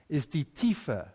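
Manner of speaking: normal talking voice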